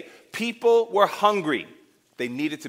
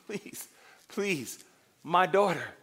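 imagined tearful plea; 5 dB quieter, slower than usual. Retold or imitated. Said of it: "and you're crying".

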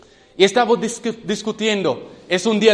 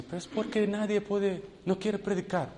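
thinking to himself; 3 semitones higher, 10 dB quieter than usual. Retold or imitated. Shy youth wondering why nobody preaches, hushed. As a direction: wonder quietly, as if only in your head. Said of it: "Y yo dije".

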